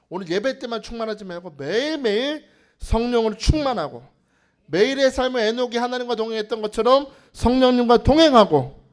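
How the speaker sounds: loud, rousing blessing over a congregation; full-force preaching. Acted. Pastor blessing the congregation to be filled daily, forceful preaching. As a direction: drive each exhortation home loudly.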